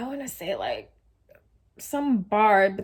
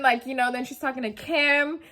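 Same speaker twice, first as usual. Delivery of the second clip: usual speaking voice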